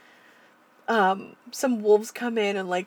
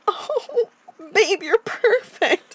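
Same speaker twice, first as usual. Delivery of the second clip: high, slow adoring squeal; gushing; 12 semitones higher, 5 dB louder, much slower than usual. Retold or imitated. A viewer gushing over Babe, high and slow. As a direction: swoon over the pig as if it's precious.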